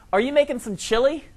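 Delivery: high, bright mock cashier voice; mock chirpy cashier. Cashier making small talk with a shopper, high mock-chirpy voice. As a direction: imitate a cheery cashier.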